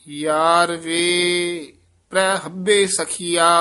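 slow measured chant of scripture; scripture recitation. Acted. Teacher recites Gurbani for students, in a measured chant. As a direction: recite each line with devotion.

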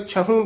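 ਛਹੂ opening on an aspirated chh; chh sound. Shown as a demonstration. Teacher modelling for a pupil, ਛਹੂ with breathy chh start. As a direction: puff air on the first sound of ਛਹੂ. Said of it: "ਨਹੀਂ, ਛਹੂ".

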